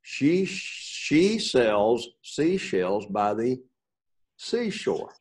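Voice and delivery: very slow, careful tongue twister. Shown as a demonstration. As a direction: take it slowly, word by word. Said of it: "Here's one".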